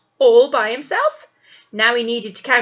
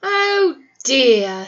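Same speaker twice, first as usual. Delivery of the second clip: louder sighing oh dear; wolf sighing after the door slams; 2 semitones higher, 5 dB louder than usual. A narrator's reading. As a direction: let out a sad sigh. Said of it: "sighed Mister Wolf".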